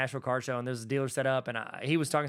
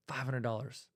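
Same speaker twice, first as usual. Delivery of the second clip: softer musing; 3 semitones lower, 7 dB quieter than usual. Shown as a demonstration. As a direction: repeat it softer, to yourself. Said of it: "you're like".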